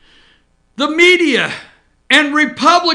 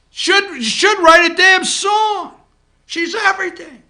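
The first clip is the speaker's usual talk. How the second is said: sarcastic shout; 6 semitones higher, 3 dB louder than usual. Real, not acted. Ranting about Loeffler's praise.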